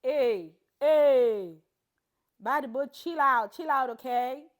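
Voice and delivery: loud, playful pidgin accent; teasing call-out. Real, not acted. Hushing herself after the accent slips out, loud teasing.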